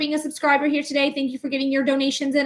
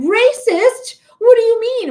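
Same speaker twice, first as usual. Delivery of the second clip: much higher, louder shocked mock voice; offended woman mock; 12 semitones higher, 8 dB louder than usual. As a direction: squeal it like a caught, indignant woman. Mimicking offended racist woman.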